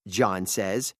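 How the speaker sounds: usual speaking voice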